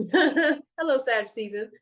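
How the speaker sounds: loud laughing hello; cheerful laugh into hello; laughing greeting. Real, not acted. Greeting Sag season laughing.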